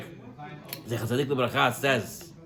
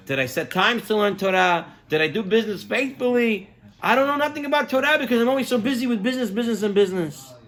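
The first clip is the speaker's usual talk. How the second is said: worried, speeding up, pitch climbing higher; anxious rush; 6 semitones higher, faster than usual. Retold or imitated. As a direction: rattle it off faster and faster in rising panic. Man panics about his judgment.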